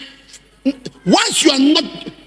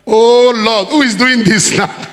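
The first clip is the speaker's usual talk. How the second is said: put-on louder, slow exasperated boy's voice; 3 dB louder, slower than usual. Boy caught with food on his mouth, louder exasperated. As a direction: play the caught boy, fed up.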